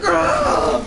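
high imitated yell; mock scream. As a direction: ape his scream. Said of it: "He's like".

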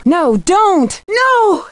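high panicked cry that rises to a scream on the last no; terrified plea. Acted. Nancy begging an attacker to stop, panicked high cry. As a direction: beg them to stop in sudden terror. Pleading as danger strikes.

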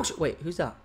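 normal talking voice

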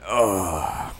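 normal talking voice

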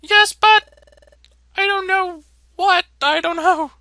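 very high, loud squeaky little mole voice; flustered Diglett. Acted. Sentry Diglett flustered about the footprint, very high squeaky panicked voice. Voicing Diglett unsure again.

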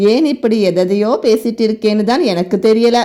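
usual speaking voice

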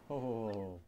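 character chuckle. Acted. A character laughs.

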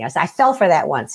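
usual speaking voice

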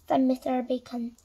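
ordinary delivery, nothing marked

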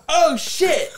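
sudden high yelp of alarm; surprised high "oh shit"; retold shocked yelp. Retold shock at broken arm.

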